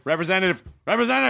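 rising, insistent repetition. Retold or imitated. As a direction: demand a human. Calling for human on phone.

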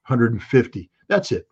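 normal talking voice

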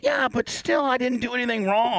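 high, insistent protest; indignant protest. Real, not acted. Protesting his innocence.

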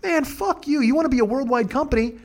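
mock indignant rant. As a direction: rail at the company, pitched high. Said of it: "but then I was like".

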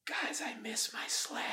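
high breathy whisper, faint and fading; whispered mock dying line. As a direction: whisper like a dying man on his deathbed. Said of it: "Let's hear".

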